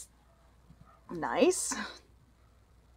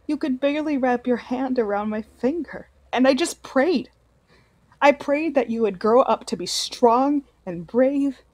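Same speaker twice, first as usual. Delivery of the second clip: ordinary delivery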